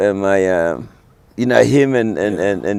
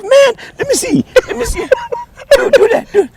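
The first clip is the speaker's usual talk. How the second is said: much higher voice begging to hear more; eager relatives; 4 dB louder than usual. Retold or imitated. Relatives at Thanksgiving begging him to speak, much higher voice. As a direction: egg him on like a thrilled uncle.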